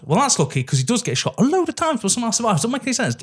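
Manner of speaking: high, incredulous rant; exasperated. Real, not acted. Podcaster ranting about a hero surviving gunshots, exasperated. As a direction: voice pushed up high in disbelief. Ranting that plot makes no sense.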